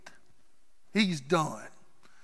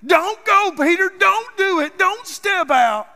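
much higher and louder, frantic begging; pleading disciples; 12 semitones higher, 9 dB louder than usual. Acted. Disciples in the boat begging Peter to stay, frantic pleading. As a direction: beg him not to jump.

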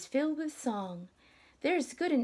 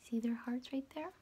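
quick unvoiced whisper; whispered aside to the children; 6 dB quieter, faster than usual. Shown as a demonstration. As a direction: point it out in a hush. Pointing out hearts to kids.

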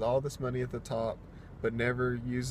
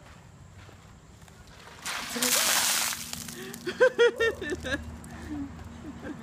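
loud gasping laugh under icy water; shrieking laugh as the cold hits; shocked laugh in ice cold water; 3 dB louder than usual. Real, not acted. Ice water poured over him.

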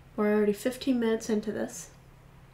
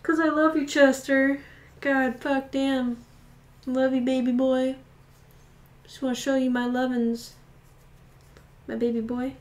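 louder, higher gushing love; 4 semitones higher, 5 dB louder than usual. Real, not acted. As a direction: pour it out loudly to the bunny.

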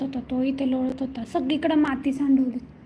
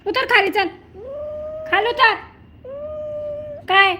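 louder, higher scolding of a sulky pet; 4 semitones higher, 4 dB louder than usual. Real, not acted. Owner scolds the sulking pet to get down. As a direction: tell him off firmly.

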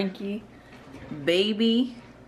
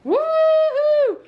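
excited cheer; 9 dB louder than usual. Real, not acted. Cheering as daddy comes home.

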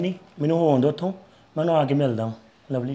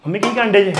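raised voice, calling out to mother in another room; 4 semitones higher, 6 dB louder than usual. Acted. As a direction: call out high and louder to reach her. Son calls mother in kitchen. (ਦੂਰੋਂ mummy ਨੂੰ ਉੱਚੀ ਆਵਾਜ਼ ਮਾਰਨਾ)

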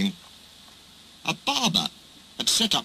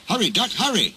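higher, urgent warning call; 7 semitones higher than usual. A narrator's reading. Driver calling to Duck to hurry, urgently. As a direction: urge them to hurry. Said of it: "he called urgently".

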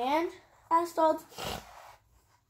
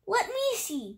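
higher-pitched demand; pushy, raised-pitch request; 7 semitones higher than usual. A narrator's reading. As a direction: insist on having a look. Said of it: "demanded Cindy".

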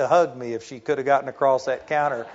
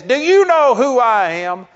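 loud, higher, outraged self-importance; indignant demand; 7 semitones higher, 8 dB louder than usual. Retold or imitated. Entitled passenger demanding the counter agent rebook him, loud and indignant. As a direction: demand it like a pompous big shot. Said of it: "And this guy says".

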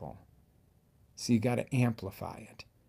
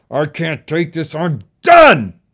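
loud, higher cry of having had enough; fed-up outburst; 7 semitones higher, 17 dB louder, slower than usual. Shown as a demonstration. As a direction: explode at your breaking point. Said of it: "That's the pain".

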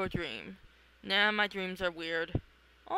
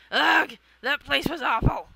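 loud, high groan of disgust; 4 semitones higher, 9 dB louder than usual. Acted. Fleeing the nightmare, loud disgusted outburst. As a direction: spit out the relief after the nightmare.

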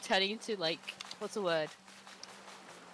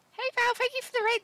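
excited; 6 dB louder, faster than usual. Real, not acted. Thanking raider.

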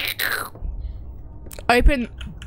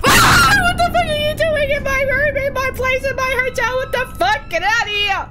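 furious scared yell; 13 semitones higher than usual. Real, not acted. Gamer screaming at the ghost in her hotel room, high yell.

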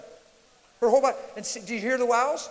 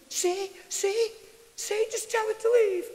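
high-pitched imitation of a panicky woman; frightened lady imitation; 12 semitones higher, 4 dB louder, slower than usual. Lady pleading with preacher over moving flashlight, high-pitched imitation. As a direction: plead in a squeaky mock-lady voice. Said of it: "She goes".

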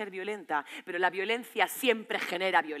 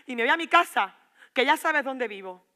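raised voice, higher and loud; defiant parting shot; 4 semitones higher, 7 dB louder than usual. Acted. Girlfriend walks out on Antonio, defiant parting shot. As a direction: throw it at him as you leave.

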